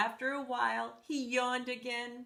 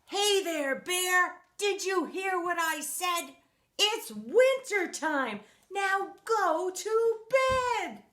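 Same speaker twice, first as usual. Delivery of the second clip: loud, higher, slow shouted scolding; yelling old man; 8 semitones higher, 6 dB louder, slower than usual. Acted. Old Man Winter yelling at Big Bear to go to bed, loud. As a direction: bellow it like a fed-up old man. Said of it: "Old Man yelled".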